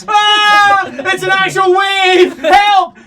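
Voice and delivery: high, loud panicked yelling; panicked shout. Pretend. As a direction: scream for help as the wave hits. GM mock panic at wave.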